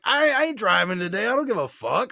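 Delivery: high carefree voice; imitating carefree Marco. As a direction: shrug it off like a tipsy buddy. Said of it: "He's like".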